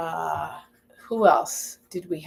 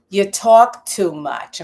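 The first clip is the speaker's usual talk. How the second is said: put-on New York accent; 8 dB louder, much slower than usual. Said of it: "with a New York accent".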